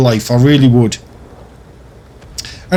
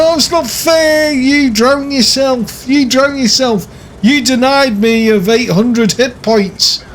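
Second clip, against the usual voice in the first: high, slow mocking whine; whiny complainer; 9 semitones higher, slower than usual. Mocking game whiners with a high whine. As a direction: mimic a whinger, drawn-out and high. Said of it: "whine and moan and say".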